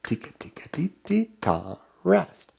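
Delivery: soft whispered rhythm echo. Shown as a demonstration. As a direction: whisper "Tika, tika, teet, teet, ta, rest" for the class. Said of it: "You say it".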